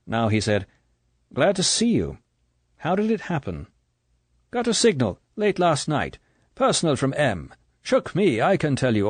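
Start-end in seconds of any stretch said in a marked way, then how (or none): 1.3-3.7 lightly voiced Bond, a little higher; a hint of a cool English agent asking; Bond's voice. Bond greets the stranger. 4.5-9.1 a light Irish brogue, a little higher; hint of brogue. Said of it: "A hint of brogue".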